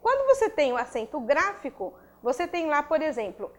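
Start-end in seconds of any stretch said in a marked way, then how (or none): none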